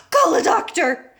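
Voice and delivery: high, loud urgent call; a father shouting for help; shouted. A narrator's reading. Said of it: "shouted Mister Beauregard".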